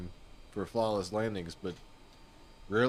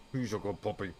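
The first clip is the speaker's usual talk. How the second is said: dog praise; 3 semitones higher, 4 dB quieter than usual. Real, not acted. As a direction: coo praise at the puppy.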